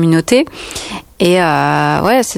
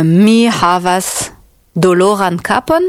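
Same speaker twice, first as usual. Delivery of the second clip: "mi havas doloran kapon" drawn out slowly; slow teaching; slower than usual. Shown as a demonstration. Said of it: "J'ai dit trois fois la même chose".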